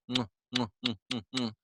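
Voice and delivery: soft smacking kisses; kissing noises. Real, not acted. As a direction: blow quiet kisses at the cat. Kissing the cat on camera.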